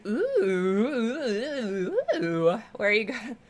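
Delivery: long stretched-out "ooh" cooing into a rising question; drawn-out excited ooh, then ask brightly; excited noise. Real, not acted. Greeting a viewer with a stretched excited ooh. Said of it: "that's my noise, apparently, of excitement".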